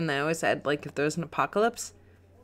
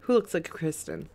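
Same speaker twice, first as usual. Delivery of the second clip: normal talking voice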